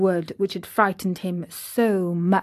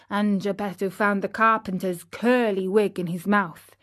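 plain reading voice

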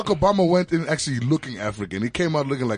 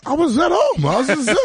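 indignant; 13 semitones higher, 4 dB louder than usual. Real, not acted. Indignant answer, pitch jump.

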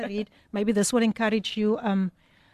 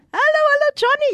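much higher, louder sing-song hello; excited greeting; 7 dB louder, faster than usual. Acted. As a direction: call out hello with a big lift. Host greeting a listener excitedly.

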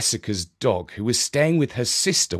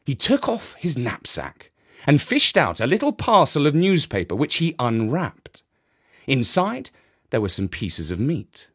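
plain reading voice

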